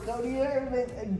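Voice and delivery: usual speaking voice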